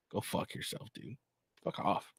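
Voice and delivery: near-silent, breathy curse; muttered curse. Real, not acted. Streamer cursing Zelensky under his breath.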